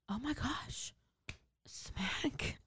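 soft, half-whispered wonder; stunned and hushed, drawing each word out; barely voiced, dazed murmur; awestruck hush. Acting her awestruck moment of collision.

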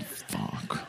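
breathless, soft whispered swear; whispered curse. Retold or imitated. Kid whispers a curse as the station laughs. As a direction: let it slip under your breath.

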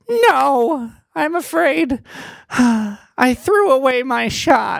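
high, slow, sighing letdown; dejected fairy. Acted. Slumped fairy telling the prisoners he gave up, slow and sighing. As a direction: sigh it out, beaten.

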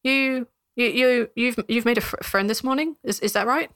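tentative, stumbling question; stammering guard. Acted. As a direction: ask awkwardly, stuttering.

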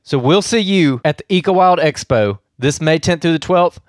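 loud, upbeat radio promo; ad read. Acted. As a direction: pitch the event like an announcer.

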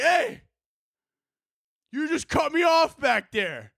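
high mock yell of outrage; retold road-rage shout; angry driver. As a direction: holler it like an aggrieved motorist. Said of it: "he got so mad at me".

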